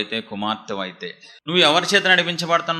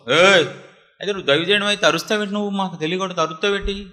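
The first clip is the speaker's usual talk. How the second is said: imitated offended back-talk, pitched up; indignant retort; 6 semitones higher, faster than usual. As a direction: play someone proud bristling at being scolded. Mimics proud people bristling at correction.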